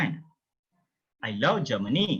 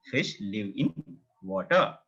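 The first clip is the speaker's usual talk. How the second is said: ordinary talk, nothing marked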